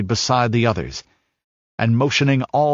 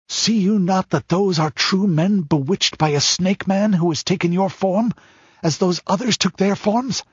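higher, pressing warrior's plea; urgent warrior voice; 7 semitones higher than usual. Acted. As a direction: urge your king on, fast and fierce.

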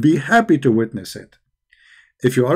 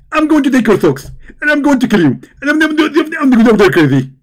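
higher, louder excited preacher impression; Zakir Naik; 8 semitones higher, 4 dB louder than usual. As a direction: lecture like a fired-up debating preacher. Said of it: "If we ask Zakir Naik".

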